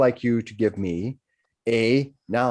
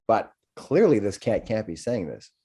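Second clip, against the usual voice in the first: ordinary talk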